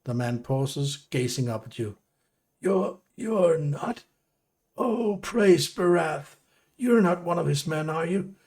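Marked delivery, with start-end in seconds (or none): 2.6-8.3 high, wavering, hopeful; nervous relief. Acted. Beaten prisoner realising help came, high and nervous. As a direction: stammer with fragile relief, pitched high. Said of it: "glances nervously".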